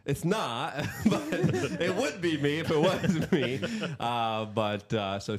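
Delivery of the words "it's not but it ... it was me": laughing reply. Retold or imitated. Retelling own reply to Tim.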